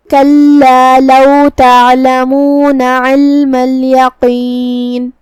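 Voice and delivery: slow, drawn-out melodic tilawat; Quran recitation. Shown as a demonstration. Teacher recites the verse for students, slow melodic chant. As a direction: chant each word long and measured like a qari.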